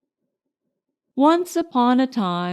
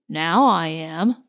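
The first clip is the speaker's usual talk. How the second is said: growly voice; 6 semitones lower than usual. A narrator's reading. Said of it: "said a growly voice".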